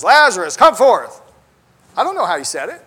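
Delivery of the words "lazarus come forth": booming preacher call. Shown as a demonstration. Preacher demonstrating a loud, dramatic command to Lazarus. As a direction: thunder it like a preacher at full force. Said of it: "sometimes preachers like to emphasize".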